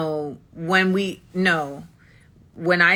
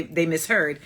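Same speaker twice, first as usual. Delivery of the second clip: ordinary talk, nothing marked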